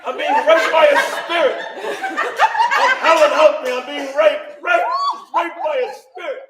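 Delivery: high, loud hammed-up cry of alarm; mock scream for help. Imagining calling to sleeping Helen for help, mock scream. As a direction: yell it out as a comic panic. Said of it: "I'm gonna have to scream".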